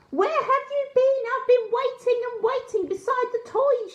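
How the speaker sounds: fretting gran, finally found him; high anxious grandmother calling out; relieved scolding grandma. A narrator's reading. Grandma finds lost grandson.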